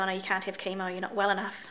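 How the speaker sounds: ordinary talk, nothing marked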